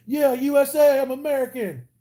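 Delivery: high-pitched, whooping USA pride; mock patriotic cheer. Streamer showing viewers how Americans act, mock cheering. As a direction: cheer it like a parody American superfan.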